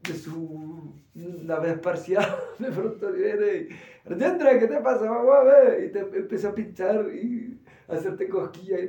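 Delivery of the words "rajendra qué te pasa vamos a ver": high, loud, playful teasing call; jolly teacher coaxing a sad student; cheerful guru. Retold or imitated. Guru voiced cheering someone up.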